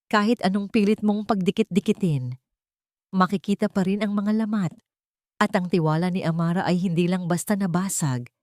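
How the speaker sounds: ordinary delivery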